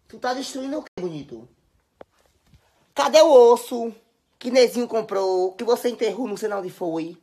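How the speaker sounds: scolding the dog. Real, not acted. Scolding the dog about bone.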